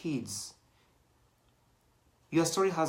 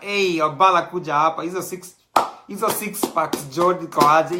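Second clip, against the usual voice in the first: loud, raised mock heckle; imitated calling out; 3 semitones higher, 9 dB louder than usual. Git hailing Obala over about the six packs, imitated loud call. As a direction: call out like an excited onlooker. Acting out audience member calling out.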